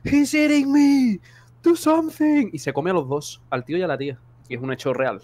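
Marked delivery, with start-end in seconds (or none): 0.0-2.5 high, slow, loud terrified cry for help; mock horror-movie scream in English; retold panicked scream. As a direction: scream it like the bear is eating you. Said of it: "y ellos gritan".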